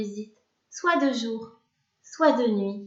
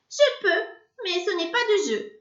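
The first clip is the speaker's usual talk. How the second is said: indignant witch; 10 semitones higher than usual. A narrator's reading. Witch voiced protesting.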